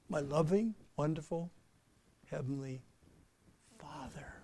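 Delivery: soft, unhurried, fading to a hush; slow calm prayer. Shown as a demonstration. Preacher showing the church how to pray slowly, soft and calm. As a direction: breathe out and savour each word. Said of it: "take a breath, slow down, concentrate".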